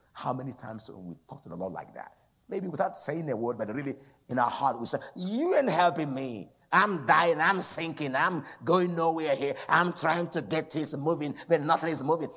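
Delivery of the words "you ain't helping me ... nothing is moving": loud, high-pitched re-enacted rant; mad outburst, acted. Driver railing at God for not helping, acted angry shout. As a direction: cry out in mock desperation, hammering each complaint. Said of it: "And I was so mad".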